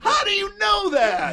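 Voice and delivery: hammed-up high giddy shriek; mock fan squeal. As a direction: gush like a starstruck fan. Acting excited fan squeal.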